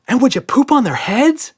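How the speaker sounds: high, loud cheeky question; girl delighted by her joke; mischievous girl. A narrator's reading. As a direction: ask it with naughty glee. Emma voiced, giggly.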